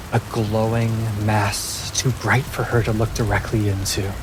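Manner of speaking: measured fantasy narration; story narration. A narrator's reading. Game master telling players what Poppy finds. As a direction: paint the storm scene for the table.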